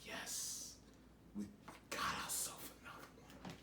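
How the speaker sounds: gleeful demon whisper. Retold or imitated. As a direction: hiss it like a demon with a new catch. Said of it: "They drag you like".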